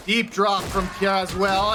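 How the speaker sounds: ordinary delivery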